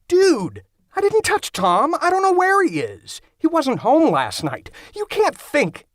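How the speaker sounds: high, frantic protest of innocence; panicked denial. Acted. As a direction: defend yourself in a high panic. Accused of killing roommate, protests.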